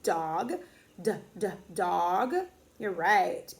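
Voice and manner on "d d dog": repeated short "d" sound leading into "dog"; low, separated "d, d" then the word "dog"; letter sound demo. Shown as a demonstration. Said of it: "what sound does that D make".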